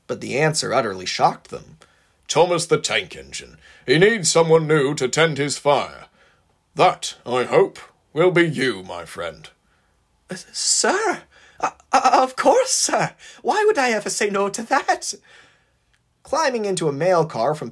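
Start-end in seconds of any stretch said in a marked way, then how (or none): 2.3-9.5 grave and unhurried offer; lightly voiced elder. Elder offering the engine to Marcel, lightly solemn. 10.3-15.2 higher, stammering and overjoyed; flustered eager youth. Acted. Marcel accepting the job, higher and flustered.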